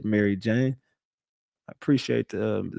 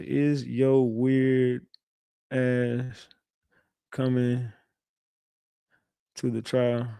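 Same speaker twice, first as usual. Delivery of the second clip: slow drawn-out texting voice; 3 dB quieter, much slower than usual. Shown as a demonstration. Dictating a taunting text slowly to Keela. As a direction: drag each word out slowly. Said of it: "I'm gonna say".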